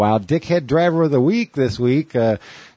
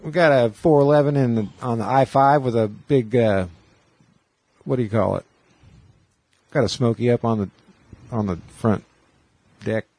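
CB trucker radio talk; 3 semitones higher than usual. Retold or imitated. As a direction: call it in like a trucker over the radio. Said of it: "they all talk like that".

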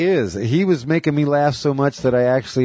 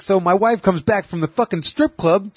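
high, pitched-up angry Boston rant; hammed-up irate comic ranting about his wife; Bill Burr rant; 9 semitones higher than usual. Retelling a Bill Burr bit in his high, angry voice. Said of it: "He talks like he's fucking pissed off".

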